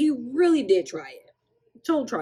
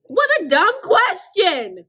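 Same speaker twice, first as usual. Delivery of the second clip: much higher, louder, slow disbelief; exasperated exclaim; 11 semitones higher, 9 dB louder, much slower than usual. Real, not acted. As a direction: throw it out in outraged disbelief. Exasperated outburst at manager.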